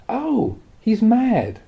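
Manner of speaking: high, very slow drawn-out realization; dawning realization. Acted. Sudden realization about the madman.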